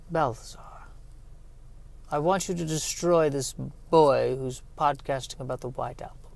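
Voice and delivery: hushed, menacing villain; sinister king whisper. Acted. The evil king orders Balthazar to destroy the podcaster, whispered menace.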